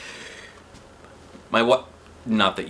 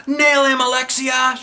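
high, loud cheer; shouted; 6 dB louder, slower than usual. Acted. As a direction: egg her on loudly. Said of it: "shouted Ryan".

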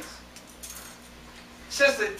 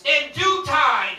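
raised, emphatic preaching; 5 semitones higher, 8 dB louder, much slower than usual. Real, not acted. Preacher promises the waiting blessing, raised emphatic preaching. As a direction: promise it to the congregation with force.

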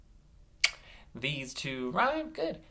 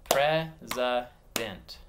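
"President" broken into separate beats; "President" slowly segmented for blending; slow syllables; 4 dB louder, much slower than usual. Shown as a demonstration. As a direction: clap out "President" slowly, pausing between syllables. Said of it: "I'm gonna say them slow and clap".

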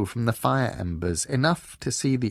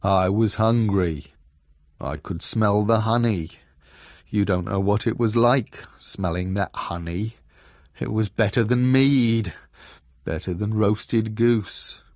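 gloomy bass voice; 5 dB louder than usual. A narrator's reading. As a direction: moan mournfully about food you longed for. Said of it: "And then the gloomy voice, so bass".

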